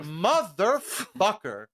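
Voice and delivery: mock outrage, syllables pulled apart; exasperated cursing. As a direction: stretch the swear in disbelief. Exasperated reaction to cruel joke.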